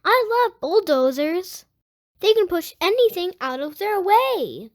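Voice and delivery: lively picture-book read-aloud; reading to children. Acted. Host reading a Blippi book to young viewers, lively read-aloud. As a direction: read it out to little kids, bright and clear.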